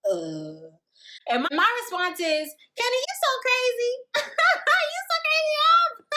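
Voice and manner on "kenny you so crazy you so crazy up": much higher and louder, giggling through it; laughing brush-off. Retold or imitated. Answering Kenny's flirting, high laughing brush-off. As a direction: squeal it off laughing.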